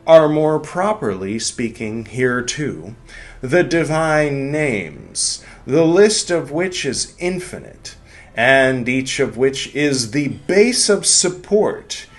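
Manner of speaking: slow, high, loud churchly intoning; ecclesiastical Latin voice. Pretend. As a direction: intone it like a priest at mass. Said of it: "my ecclesiastical, uh, Latin voice".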